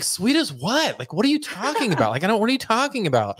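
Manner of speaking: retold bafflement. Acts out his past bafflement.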